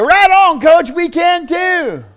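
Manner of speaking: loud rallying cheer from the team. Retold or imitated. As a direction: shout it like fired-up players. Players cheering coach on.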